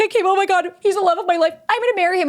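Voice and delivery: put-on high, squealy lovestruck teen; mimicking a teen gushing over her crush; gushing teenager. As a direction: play a giddy teenage girl in love. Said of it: "like, a teenager".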